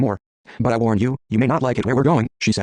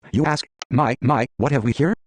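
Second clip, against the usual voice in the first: ordinary talk, nothing marked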